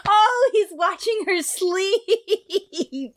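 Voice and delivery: high, drawn-out cry through laughter; amused squeal of recognition; laughing realization. Real, not acted. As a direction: stretch it out high while cracking up. Breaks up recalling the joke.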